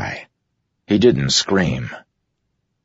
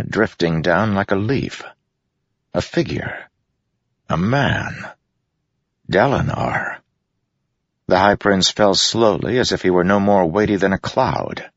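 usual speaking voice

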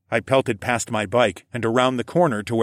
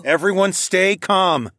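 higher, slow, firm call; commanding call for calm; 9 semitones higher, 4 dB louder, slower than usual. A narrator's reading. Narrator tries to calm the group, firm raised voice. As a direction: raise the voice to steady everyone.

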